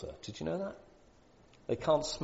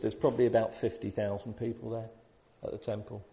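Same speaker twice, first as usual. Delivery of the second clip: ordinary delivery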